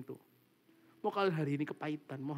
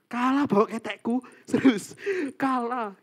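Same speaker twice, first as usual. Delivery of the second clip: laughing; 8 semitones higher, 10 dB louder, slower than usual. Real, not acted. Joking about smelly room.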